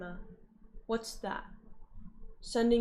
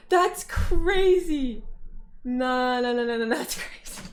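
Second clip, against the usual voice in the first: drawn-out, louder "that's crazy"; loud disbelief; 6 dB louder, slower than usual. Real, not acted. Reacting to a chat message about the mom, loudly disbelieving.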